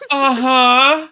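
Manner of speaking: high, rising little uh-huh; mock-dazed Padme. Fan mocking Padme's odd reply to the trooper, high-pitched. Acted quote of the fallen Padme.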